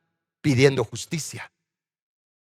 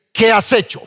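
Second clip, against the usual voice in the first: loud, higher-pitched accusing question; stern confrontation; 6 semitones higher, 5 dB louder than usual. Acted. God confronting Cain over his brother, stern and loud. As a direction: confront the wrongdoer forcefully.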